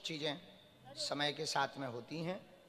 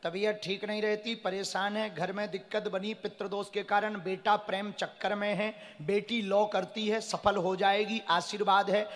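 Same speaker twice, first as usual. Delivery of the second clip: raised proclamation building ever louder; proclaiming the slip; 6 semitones higher, 11 dB louder than usual. Acted. Guru reading a devotee's slip to the crowd, building proclamation. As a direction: read the fortune like a public pronouncement, swelling. Reads the prophecy slip aloud.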